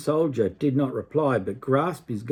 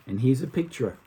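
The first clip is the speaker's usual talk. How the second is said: plain reading voice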